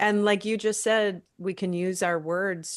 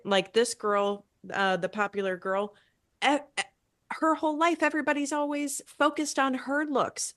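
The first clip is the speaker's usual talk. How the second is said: usual speaking voice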